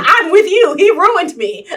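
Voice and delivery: high, loud mock agreeing cry; hammed-up sympathetic outburst; mock agreement. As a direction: loudly play along with him. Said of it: "and I'm like".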